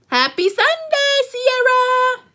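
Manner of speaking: high, drawn-out sing-song hello; sing-song greeting. Real, not acted. Greeting a viewer on the live stream in a high sing-song.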